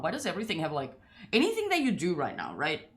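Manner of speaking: ordinary talk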